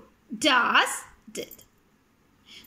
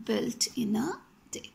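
whisper; slower than usual. Shown as a demonstration. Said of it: "So here".

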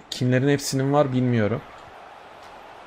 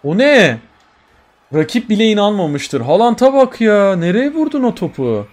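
excited shout; 7 semitones higher, 6 dB louder than usual. Real, not acted. Amazed at Haaland's shot.

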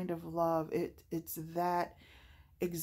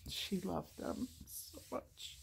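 a near-silent, tear-choked voice, far softer; fighting tears over her late mother's love; holding back tears; 9 dB quieter, slower than usual. Real, not acted. A mother's grief, choked and whispered. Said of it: "Oh, I'm not gonna cry".

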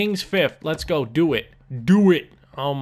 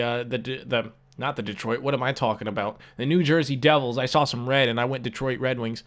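normal talking voice

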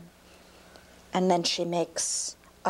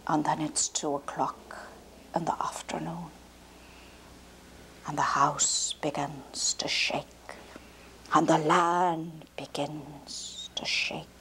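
hushed storytelling; 4 semitones lower, 4 dB quieter than usual. A narrator's reading. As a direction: whisper it as the ground starts trembling. Hushed build-up before giant arrives.

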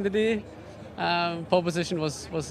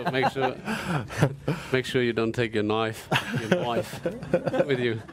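mock Australian accent, a bit lower; Aussie accent; 3 semitones lower than usual. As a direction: put on an Aussie twang. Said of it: "It's Aussie accent".

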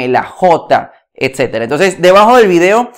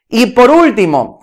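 ordinary talk

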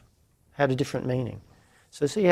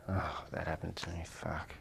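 low, much softer, defeated groan of self-pity; deflated victim; 8 semitones lower, 18 dB quieter than usual. Shown as a demonstration. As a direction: sink into it like the world is against you. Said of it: "depending on what meaning you place upon it".

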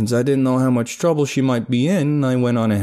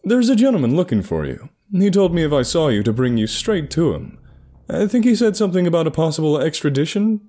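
lightly raised put-on voice; a hint of a disguise voice; fake voice; 6 semitones higher than usual. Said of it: "keep a fake walk and voice going".

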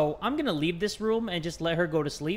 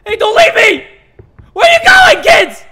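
very high, very loud yelling; panicked shout; 15 dB louder than usual. Real, not acted. Player calling to the departing kids, panicked shout. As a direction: scream after them to stay.

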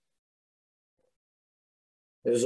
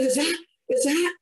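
choked up. Real, not acted. Seeing his dead son again.

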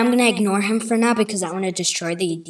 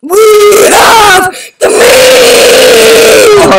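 much higher, very slow, loud ad shout; shouted slogan; 11 semitones higher, 17 dB louder, much slower than usual. Pretend. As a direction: scream the slogan, stretched out. Kid shouts Arby's slogan.

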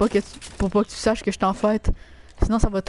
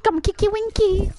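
slow, very high put-on voice; silly high voice; 11 semitones higher, slower than usual. Streamer joking in group chat, silly high voice. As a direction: draw it out up high, playfully.

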